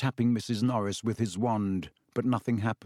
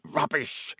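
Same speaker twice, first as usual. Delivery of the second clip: snarled; 3 semitones higher than usual. A narrator's reading. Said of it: "snarled Filch".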